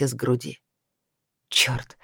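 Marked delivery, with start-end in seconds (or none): 1.5-2.0 whispered curse. Acted. Heroine curses under breath.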